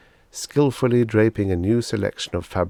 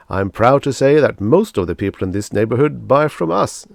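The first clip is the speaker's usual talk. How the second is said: louder, beaming salesman flattery; gushing salesman; 4 semitones higher, 8 dB louder than usual. Acted. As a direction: lay the praise on thick. Salesman Pathis boasts.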